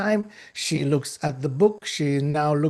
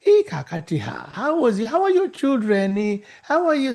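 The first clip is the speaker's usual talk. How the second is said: lightly voiced chatty greeting, rising higher; a hint of the clerk gushing over a friend; 5 semitones higher than usual. Clerk chatting to the next table, lightly voiced and higher. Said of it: "And she's saying".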